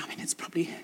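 hushed, breathy aside; whisper. Retold or imitated. Dodging the elders' question, whispering. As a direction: drop to a whisper mid-sentence. Nervous aside under interrogation.

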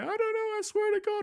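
very high, pleading cop voice; frightened corrupt cop. Acted. Corrupt cop being hauled up a building, high panicked pleading. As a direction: squeal it like a cornered crooked cop. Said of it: "the corrupt cop".

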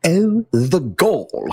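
high, very drawn-out echo of the exclamation; hammed-up scandalized parody; mock indignation. Playfully echoing the mock exclamation.

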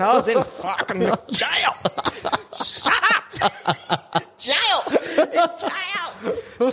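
very high-pitched, laughing mock outrage; outraged protest. Drinker telling his mate where he spent the night, outraged protest. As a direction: act out an incredulous squawk through laughter. Acted shouting match about jail.

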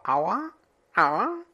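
high, meowing cat asking for water. Retold or imitated. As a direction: mew the word like a thirsty cat. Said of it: "Really, in a cat voice".

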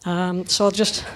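ordinary talk, nothing marked

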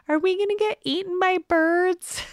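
very high mock child voice; little boy. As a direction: imitate a worried kid asking in a high squeak. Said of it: "the son of the kids is like".

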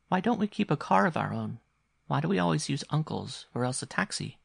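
put-on high, questioning child's voice; young boy. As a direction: ask like a puzzled little boy. Boy questions his mother.

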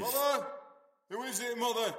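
high-pitched call to mother. Acted. Grown son calling his mother from another room, high. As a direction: call out from far off.

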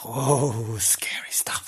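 hushed mock-scary whisper; spooky whisper. Host spooking listeners about screams in an empty house, whispering. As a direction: whisper it like a ghost story.

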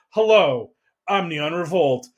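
ordinary talk, nothing marked

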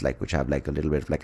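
ordinary talk, nothing marked

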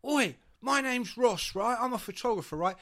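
loud, high Cockney phone rant; Cockney lunatic. Acted. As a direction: bellow it like a pushy Cockney. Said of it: "this kind of Cockney lunatic on the phone".

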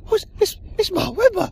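high, breaking squeal of excitement; starstruck squeal. Retold or imitated. Spotting Mark Webber on the stairs, a high starstruck squeal. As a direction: shriek it like a starstruck fan. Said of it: "and I was like".

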